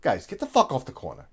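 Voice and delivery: low, softened order with a curse in it; professional. Shown as a demonstration. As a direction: curse without raising your voice, controlled. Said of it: "do it in a way that's professional".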